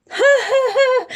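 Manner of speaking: high, loud hearty ha ha; hammed-up bear belly laugh; roaring laugh. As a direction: roar with laughter. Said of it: "roared the bear".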